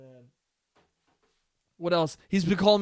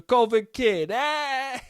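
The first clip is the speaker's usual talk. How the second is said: higher, crowing mock cheer; mocked gleeful dad; 7 semitones higher, much slower than usual. Son imitating dad pleased with his joke, mock glee. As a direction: crow the nickname with a silly cry.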